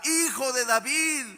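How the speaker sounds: grito agudo y fuerte, suplicante; grito de Bartimeo. Retold or imitated. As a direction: clama al Hijo de David a todo pulmón. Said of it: "por eso le gritaba". (Bartimeo gritando desde el camino, súplica fuerte)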